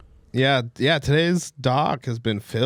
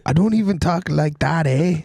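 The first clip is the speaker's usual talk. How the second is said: higher-pitched Canadian protest; mock Canadian accent; 5 semitones higher, 3 dB louder than usual. Canadian character denying the accent, mock accent. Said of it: "do a Canadian".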